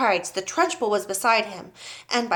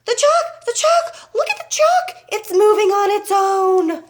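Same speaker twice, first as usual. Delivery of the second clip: very high, shrill schoolboy screaming; hysterical scream; 12 semitones higher, 8 dB louder than usual. A narrator's reading. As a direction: scream in excited alarm, loud and high.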